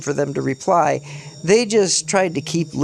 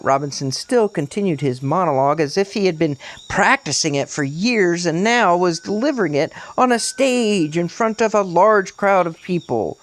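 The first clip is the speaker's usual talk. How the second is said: ordinary delivery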